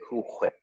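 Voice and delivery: "Cool Whip" with a heavy H in "Whip"; "Cool Whip" with an exaggerated "hw"; Stewie. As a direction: say "Whip" as "hhwip". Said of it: "think of Stewie going".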